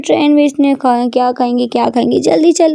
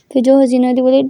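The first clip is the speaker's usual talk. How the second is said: ordinary delivery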